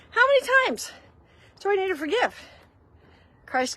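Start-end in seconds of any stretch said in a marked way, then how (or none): 0.1-2.3 high, loud exasperated plea; Peter asking. Retold or imitated. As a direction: whine it up high like a fed-up disciple. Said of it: "So when Peter says".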